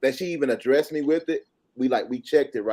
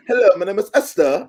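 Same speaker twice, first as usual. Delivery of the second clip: imitating a London woman's accent, higher and louder; 8 semitones higher, 8 dB louder than usual. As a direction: do her London accent, a bit of a show. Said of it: "accent messed me up".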